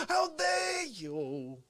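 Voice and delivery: high, slow hammed-up yell of offence; mock outrage. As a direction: drag out how dare you in fake fury. Mock outraged Fauci character.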